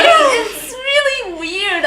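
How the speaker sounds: high, slow, half-crying mock whine; whiny disbelief. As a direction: drag it out like you can't believe it. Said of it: "I'm like".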